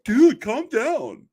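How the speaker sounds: high, slow, sneering troll voice; mocking the troll. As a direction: drag it out like a smug know-it-all. Mimicking troll's condescending line.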